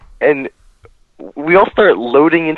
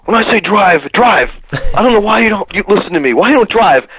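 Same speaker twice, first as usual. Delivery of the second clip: pitched up, mock-grumbling complaint; 7 semitones higher than usual. [name] grumbling at Todd for not driving, mock-irritated. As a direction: gripe at the driver, half-hammed. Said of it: "he's just grumbling to himself".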